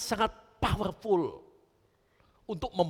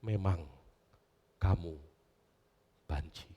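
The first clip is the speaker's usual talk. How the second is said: cold father; 12 semitones lower, 6 dB quieter than usual. Acted. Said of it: "Papa saya justru berkata".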